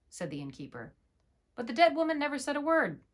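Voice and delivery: ordinary delivery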